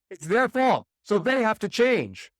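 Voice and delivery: high, loud hammed-up protest that drops back down; mock outrage, loud, high at first then speeding up; mock blaming. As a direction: keep acting the indignant blamer. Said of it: "is what we say".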